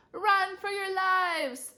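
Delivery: high, loud, drawn-out shout. A narrator's reading. New kid chased by a goat, shouting. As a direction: shout a slow, stretched warning up high. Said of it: "shouted Neil".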